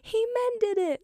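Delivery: Michael. Retold or imitated. Boy sees the fixed kite, mock yell in high voice. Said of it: "Michael just yells".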